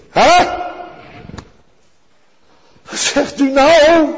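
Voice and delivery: high, loud, stunned disbelief; shocked gasp. Acted. Preacher voicing a stunned debtor hearing her debt, shrill shock. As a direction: shriek it out, the debtor can't believe the sum.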